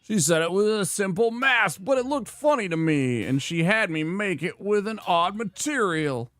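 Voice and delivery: hint of a fed-up village blacksmith; grumpy smithy. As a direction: play the cranky smith complaining about his apprentice. Gruff smithy NPC voice read aloud.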